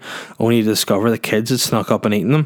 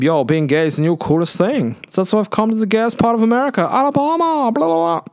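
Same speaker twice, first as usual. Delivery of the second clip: much higher camp voice, rising higher on Alabama; Bruno impression; 11 semitones higher than usual. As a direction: play a gushing camp TV character. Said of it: "go watch his clips".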